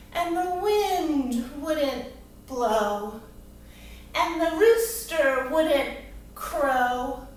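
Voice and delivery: slow, drawn-out storytelling verse; poem recitation. Acted. Teacher performing a rhyming poem for children, slow theatrical recitation. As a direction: recite it slowly, lingering on each rhyme.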